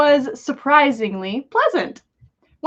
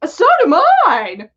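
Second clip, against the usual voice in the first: slow, louder, smug boast; wolfish grin; 8 semitones higher, 7 dB louder, slower than usual. A narrator's reading. Tom boasting to Albus with a wolfish grin. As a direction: savor it like a predator. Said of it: "announced with a wolfish grin".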